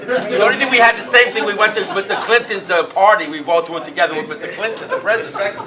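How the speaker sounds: loud, high, laughing through the words. Real, not acted. Laughing complaint about rival.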